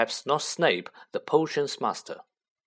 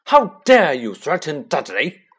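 loud furious roar; 4 semitones higher, 10 dB louder than usual. Acted. Uncle roars at Harry defending his son, furious roar. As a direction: roar in outrage. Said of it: "roared Uncle Vernon".

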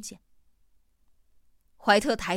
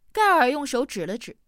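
usual speaking voice

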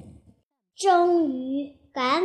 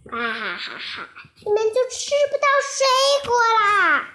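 gloating villain; 5 semitones higher than usual. Acted. Big bad wolf taunting the kids, gloating cackle. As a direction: laugh at them, then jeer.

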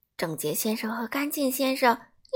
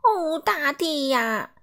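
slow, higher and dismayed; shocked exclaim; 5 semitones higher, 4 dB louder, much slower than usual. A narrator's reading. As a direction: moan it out in horror. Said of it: "第二次这样叫道". (拉长声音、惊愕地叫)

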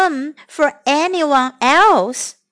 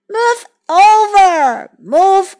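big, drawn-out call from the water; slow, stretched storybook bellow; deep voice; 4 semitones higher, 5 dB louder, slower than usual. A narrator's reading. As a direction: call out slowly as a large creature. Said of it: "said a deep voice from the water".

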